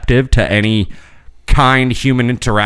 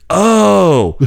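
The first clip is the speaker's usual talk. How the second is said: high, drawn-out mock surprise; quoting the nurse's dawning Oh; mock surprised Oh; 8 semitones higher than usual. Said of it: "She goes".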